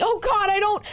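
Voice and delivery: very high, loud broken wail; a girl crying into her friend's shoulder; sobbing. Acted. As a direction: break down sobbing mid-phrase. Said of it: "started sobbing".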